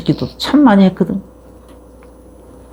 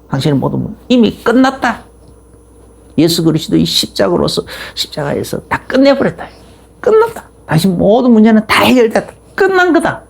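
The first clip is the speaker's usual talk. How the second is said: declaring the gospel; 4 semitones higher than usual. Shown as a demonstration. Preacher proclaiming to a new believer, authoritative declaration. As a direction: proclaim it as settled fact, with 끝났다 and 끝난 거다 flung up high. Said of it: "복음을 때로는 선포도 하고".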